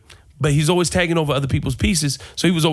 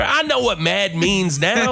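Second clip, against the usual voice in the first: higher, slow, emphatic retort; exasperated; 6 semitones higher, slower than usual. Retold or imitated. Cutting off his roommate's explanation, exasperated. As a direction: act out fed-up insistence, pitched up.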